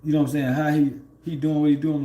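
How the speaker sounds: ordinary talk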